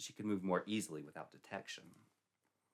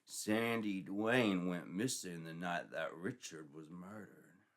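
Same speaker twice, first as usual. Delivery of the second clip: slow, level police sergeant; stern sergeant; 2 semitones lower, slower than usual. Acted. Sergeant tells Sandy the facts, slow and stern. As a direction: lay out the cold facts slowly.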